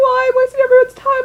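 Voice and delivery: imitating her teenage self sobbing; mock sobbing wail. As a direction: wail it like a breakdown in the ambulance. Retold sobbing breakdown.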